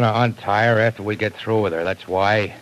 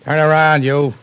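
loud, higher commands to the captive; barked order; 8 semitones higher, 7 dB louder than usual. Acted. Gangster orders his captive to turn, shouting. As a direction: shout her round like a threat.

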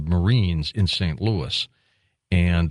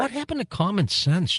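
pitched way up in outrage; indignant outburst; 12 semitones higher than usual. Real, not acted. Host railing against lost common sense, indignant. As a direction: demand it, exasperated. Host's rising indignant rant.

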